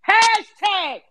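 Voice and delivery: gleeful high sign-off. Pretend.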